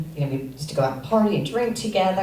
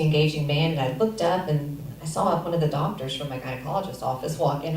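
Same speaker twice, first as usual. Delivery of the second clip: ordinary talk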